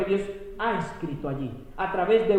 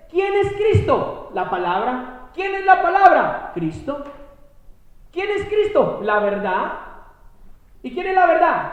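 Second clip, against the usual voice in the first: higher, louder rising questions; preaching call; 7 semitones higher, 6 dB louder than usual. Real, not acted. Preacher quizzing the congregation, loud high questions. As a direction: fire each question at the crowd.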